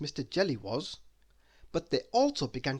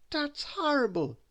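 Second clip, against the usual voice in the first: first mate; 12 semitones higher than usual. A narrator's reading.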